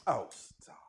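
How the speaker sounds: bashful whisper. Real, not acted. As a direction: whisper it shyly. Bashful at compliment.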